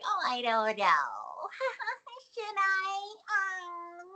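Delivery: put-on high coy baby voice. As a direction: simper coyly like a baby. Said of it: "she puts on her worst baby voice".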